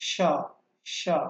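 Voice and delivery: সা opening on sustained airy sh; voiceless sound. Shown as a demonstration. Teacher contrasts the throat feel, voiceless hissed সা. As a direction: hold the hiss, then open into সা. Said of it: "বা voiceless".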